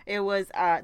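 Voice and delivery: ordinary talk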